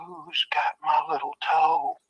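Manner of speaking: slow, drawn-out eerie moan; haunt the line like a ghost hunting its toe; ghostly voice. Retold or imitated. Dead man searching the house for his lost toe, slow ghostly moan. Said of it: "it sounded like".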